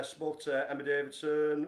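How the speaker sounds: ordinary delivery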